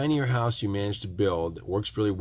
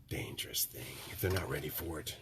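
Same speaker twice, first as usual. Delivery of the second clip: whispering; 7 semitones lower, 13 dB quieter than usual. Real, not acted. Aside after provoking remark.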